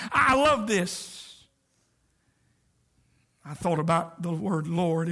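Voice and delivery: ordinary talk, nothing marked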